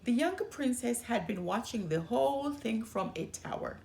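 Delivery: plain reading voice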